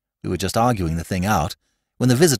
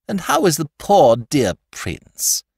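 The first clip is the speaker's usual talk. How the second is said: slow, higher, fussing matron; cooing over the poor prince; higher cooing lady; gushing society lady; 6 semitones higher, 4 dB louder, slower than usual. A narrator's reading.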